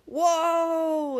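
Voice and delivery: high, loud startled cry; startled whoa. A narrator's reading. Both riders hitting the first bump, a high loud whoa. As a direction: yelp as the sled hits a bump. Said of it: "they both cried".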